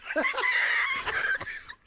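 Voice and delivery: high shrieking cackle. Real, not acted. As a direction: cackle wildly at the joke. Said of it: "not the cackle".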